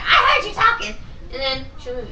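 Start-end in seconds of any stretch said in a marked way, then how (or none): 0.0-1.0 high, loud mock teacher snap; imitating teacher. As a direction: bark it like the strict teacher. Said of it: "she'll be like".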